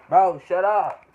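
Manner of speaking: loud sharp yell; yelling at dog. Real, not acted. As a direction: snap loudly at the dog. Yelling at his barking dog.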